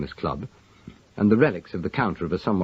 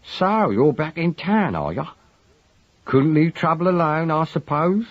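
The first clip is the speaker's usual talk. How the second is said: studied casualness; 9 semitones higher than usual. A narrator's reading. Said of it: "in a tone of studious casualness".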